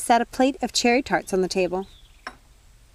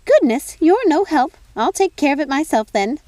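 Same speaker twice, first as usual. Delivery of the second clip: high, louder, huffy exasperation; huffy fairy godmother; 7 semitones higher, 7 dB louder than usual. Acted. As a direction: throw up your hands at your useless sister.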